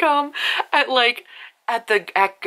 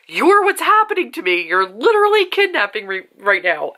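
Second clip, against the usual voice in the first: heroine's retort; 5 semitones higher than usual. Retold or imitated. Heroine protesting to the mountain man, high mock-indignant squeak.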